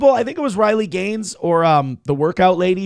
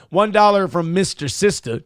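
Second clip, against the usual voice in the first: ordinary talk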